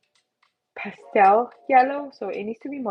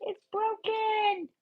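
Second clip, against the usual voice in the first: mock panic; 12 semitones higher, 5 dB quieter, slower than usual. Mock panic over drawing.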